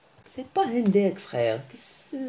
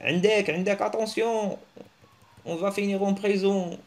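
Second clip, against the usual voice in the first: mock alarm; 7 semitones higher than usual. Imitating panicked warning about police.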